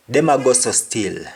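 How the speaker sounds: patois Them a go so still; Jamaican patois. Shown as a demonstration. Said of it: "Meaning, they are still acting like that".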